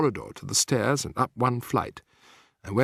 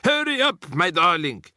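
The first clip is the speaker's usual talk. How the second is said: raised voice calling up from below; 6 semitones higher, 4 dB louder than usual. A narrator's reading. Voice below the balcony calling out loudly. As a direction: call out loudly and high. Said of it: "it shouted".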